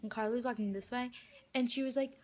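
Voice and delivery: usual speaking voice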